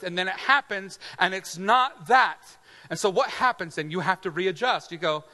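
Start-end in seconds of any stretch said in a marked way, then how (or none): none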